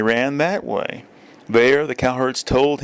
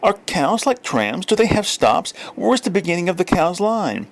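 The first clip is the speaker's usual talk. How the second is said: put-on higher curious child voice; children asking; 7 semitones higher than usual. A narrator's reading. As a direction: ask it like a wondering little kid. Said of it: "the children said".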